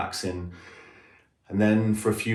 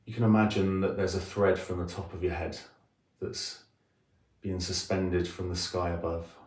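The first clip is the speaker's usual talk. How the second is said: softer meditation voice with long pauses; guided meditation; 4 dB quieter than usual. Acted. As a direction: guide them gently into a body scan, leaving space between phrases. Leads a guided body scan.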